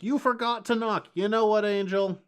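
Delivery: high, loud retold one-liner; mock loud quip. Podcaster retelling Angel's comeback to the demon, loud and high. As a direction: belt the smug line out.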